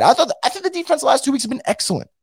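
ordinary talk

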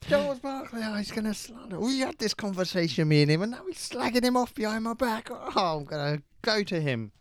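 high, hammed-up indignant wail; mock outrage. Cohost complaining about the slander of Barkley, in a high mock wail. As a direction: wail about the betrayal, half joking.

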